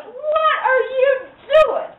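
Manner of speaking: put-on high, slow little girl. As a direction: ask it high like a small girl. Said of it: "She goes".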